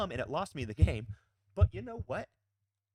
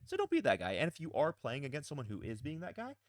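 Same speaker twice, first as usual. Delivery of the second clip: ordinary talk, nothing marked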